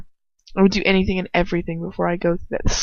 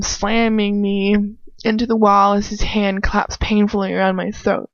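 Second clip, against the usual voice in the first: ordinary delivery, nothing marked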